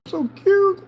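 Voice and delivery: high squeaky coo; sympathetic aww. Real, not acted. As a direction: squeal with delight at the pet. Cooing over the cat.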